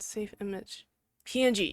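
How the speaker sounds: normal talking voice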